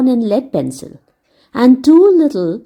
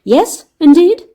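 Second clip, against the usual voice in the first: louder, eager yes; eager boy; 8 semitones higher, 6 dB louder than usual. Acted. Dick answering Humpty Dumpty, eager. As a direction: confirm it keenly.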